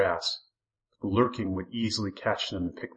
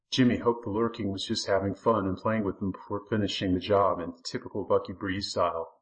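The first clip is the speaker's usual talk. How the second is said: ordinary delivery, nothing marked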